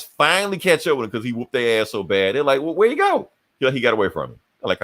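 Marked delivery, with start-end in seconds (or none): 2.6-3.3 mock bafflement. Robins acting baffled by the escape.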